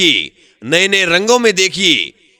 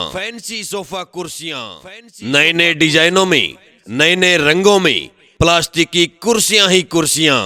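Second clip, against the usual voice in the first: usual speaking voice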